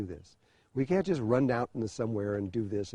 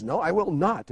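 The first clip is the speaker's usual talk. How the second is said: higher, louder mock yell of defiance; the queen shouts back her refusal; retold yelled no, in a shouting match; 5 semitones higher, 4 dB louder than usual. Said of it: "pretty soon they're screaming at each other".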